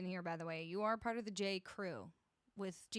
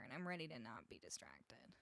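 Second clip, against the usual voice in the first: whispering; 11 semitones lower, 12 dB quieter than usual. Real, not acted. Whispered aside to chat.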